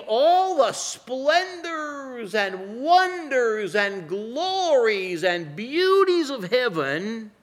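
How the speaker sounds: slow, stretched sermon build; slow, lifted pulpit delivery; slow preaching. Acted. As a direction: draw out the wonders of heaven.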